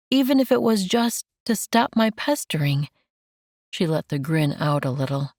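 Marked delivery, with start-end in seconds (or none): 0.1-2.9 lightly raised, tender woman's voice; gentle Mandy. Acted. Mandy comforting Enoch, lightly raised and tender.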